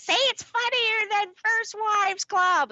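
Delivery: high, slow mock whiny demand; demanding boss. Old boss demanding praise, high whiny mock order. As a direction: needle like an insistent boss.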